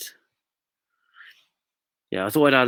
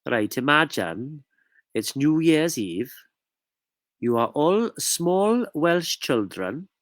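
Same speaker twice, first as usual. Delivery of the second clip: Welsh accent. Shown as a demonstration. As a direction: read a spooky tale in a put-on Welsh accent. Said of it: "I'm gonna read this in Welsh".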